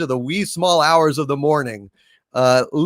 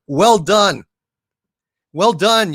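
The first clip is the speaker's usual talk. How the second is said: sarcasm; 3 semitones higher, 4 dB louder, slower than usual. Real, not acted. Sarcastic praise of opponents.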